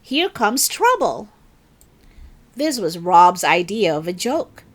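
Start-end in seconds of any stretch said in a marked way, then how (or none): 0.0-1.3 high, louder teasing call; a kid jeering at a clumsy classmate; mocking shout. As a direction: tease her loudly as she walks in. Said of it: "Rob Mason said".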